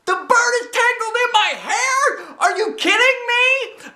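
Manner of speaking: panicked screech. A narrator's reading. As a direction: shriek in disbelief. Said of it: "I raised my screech even louder".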